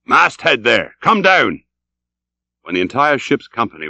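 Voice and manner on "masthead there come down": captain's shouted order; calling out. Acted. Ahab hails the masthead lookouts.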